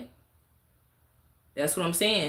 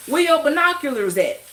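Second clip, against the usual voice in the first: high, loud sarcastic jeer; sneering high jab; mocking question; 9 semitones higher, 6 dB louder than usual. Real, not acted. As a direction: taunt them, pitch up. Mocking watchers.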